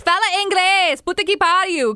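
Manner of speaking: high-pitched comic complaint in Portuguese; exasperated outburst. Retold or imitated. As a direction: burst out in playful exasperation. Retold frustration at Portuguese-speaking house party.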